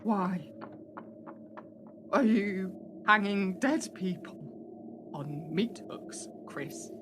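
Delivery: hushed, slow whisper under the breath. Acted. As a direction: keep it low so they can't hear. Tense whispered accusation in hiding.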